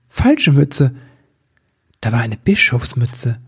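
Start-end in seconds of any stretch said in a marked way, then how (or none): none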